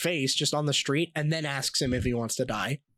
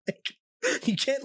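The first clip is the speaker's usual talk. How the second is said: laughing; 10 semitones higher, much slower than usual. Real, not acted. Laughing at gun joke.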